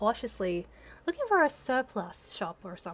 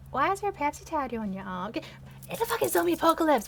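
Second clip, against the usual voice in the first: ordinary delivery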